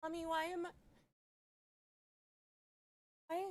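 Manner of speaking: put-on high, frightened child's voice; small lost child, voice way up and trembling; scared little girl. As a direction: play a terrified little girl calling for mommy.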